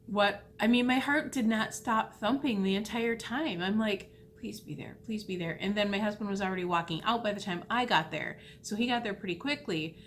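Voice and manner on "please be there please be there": retold whispered plea, under the breath; half-whispered retold wish; hushed pleading. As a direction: plead softly to yourself, nearly voiceless. Retold anxious silent pleading to herself.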